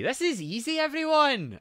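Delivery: high, loud rising squeal; high-pitched boast. Acted. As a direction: sing it out high to the crowd. Hyping chat that it is easy.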